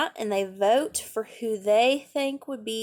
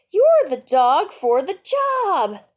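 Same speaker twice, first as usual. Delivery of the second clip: put-on loud, higher, slow proud child's voice; proud girl; 7 semitones higher, 7 dB louder, slower than usual. A narrator's reading. Jetta tells her dog Mac he's right for the job, loud and proud.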